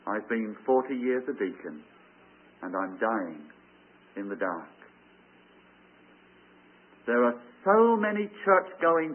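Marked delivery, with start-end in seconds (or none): 0.1-4.7 fading whisper. Retold or imitated. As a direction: lower and softer, dying away to a whisper. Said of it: "the last whispering word was".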